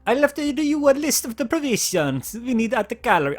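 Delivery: put-on a clumsy stab at an Italian cook's accent; bad Italian accent. Italian cook [name] tells Jake about the list, bad Italian accent. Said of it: "that attempt at an accent. Really bad".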